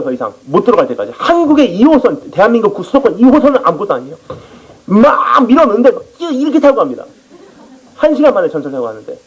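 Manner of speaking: ordinary delivery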